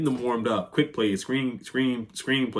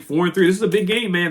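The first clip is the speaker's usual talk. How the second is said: excited; 8 semitones higher, 7 dB louder than usual. Real, not acted. Fired-up stakes for big game.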